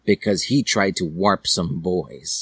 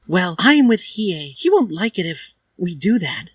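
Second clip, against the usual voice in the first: Dally's voice; 11 semitones higher than usual. Acted. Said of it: "she said".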